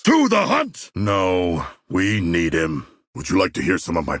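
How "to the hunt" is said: high rallying shout; rally the squad to the hunt; battle cry. Acted. Shark warrior rallying the squad, high battle cry.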